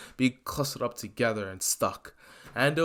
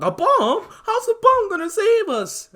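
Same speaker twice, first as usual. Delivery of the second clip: shocked squeal; 8 dB louder than usual. Acted. Mineta panicking at the plan.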